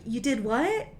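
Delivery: taken-aback question with a sharp rising end; mock disbelief, pitch swooping up on the last word; incredulous. Viewer reacting to Vic calling the ex-wife, incredulous. Acting out her shocked reaction.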